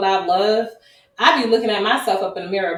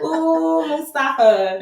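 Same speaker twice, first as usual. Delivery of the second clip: teasing sing-song; 8 semitones higher, 5 dB louder, much slower than usual. Retold or imitated. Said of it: "I'm just like".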